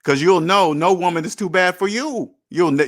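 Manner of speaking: usual speaking voice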